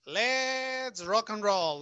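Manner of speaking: high, loud, stretched-out kickoff; rallying call. Real, not acted. Teacher restarting the lesson for students, rallying call. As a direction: call it out big and slow.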